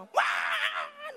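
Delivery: high-pitched mock bawling; long high baby "waaah"; imitated baby wail. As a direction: burst out like a crying baby. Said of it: "nangis terus".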